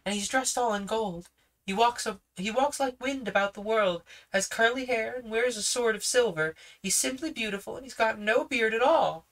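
put-on high, fast, eager girl's voice; a confident girl speaking up; young girl Judy. Judy defends her brother to the policeman, high and eager. Judy's lines.

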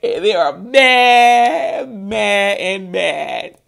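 loud, stretched-out gloating, settling down; mocking emphasis. Real, not acted. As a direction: draw out each word in amused mockery. Mocking how mad they are.

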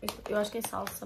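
usual speaking voice

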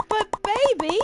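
high, loud mock plea; hammed-up desperate partner; pleading lover.

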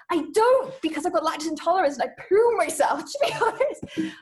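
high, loud confession into laughter; giggly blurting. Acted. Applicant overshares about her stomach, high laughing blurt. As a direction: blurt it out and crack up.

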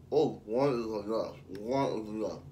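talking with potato in mouth; words round a mouthful of food; mouth full. Real, not acted. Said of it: "two potatoes in my mouth".

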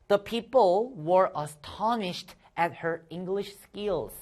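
very slow, careful read-aloud; slow model reading. Shown as a demonstration. Teacher leading the class read-along, very slowly. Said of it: "같이 읽어볼게요".

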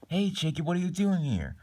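high-pitched, startled hello; surprised greeting. Acted. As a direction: greet a friend unexpectedly, pitch well up. Acted surprise meeting a friend.

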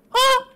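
short high, loud mimicked cry; imitated shriek. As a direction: imitate her with one piercing cry. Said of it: "That's how she talks".

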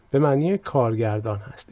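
ordinary delivery

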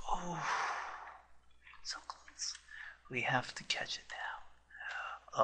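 slow urgent whisper; tense whisper. Real, not acted. Tense catch attempt.

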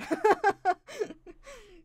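big high burst of laughter; loud laughter. Real, not acted. Laughs at her own joke.

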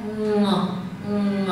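"Ng" hummed through the nose; nasal ng. Shown as a demonstration. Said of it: "dư âm phát ra là mũi".

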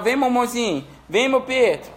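high-pitched beckoning call; singsong call to a pet. Real, not acted. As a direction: coax the other animal to come. Calls the other pet over.

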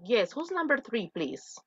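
normal talking voice

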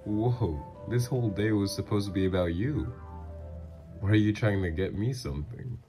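taken aback and protesting; surprised protest. Acted. Protesting a gift during shopping date.

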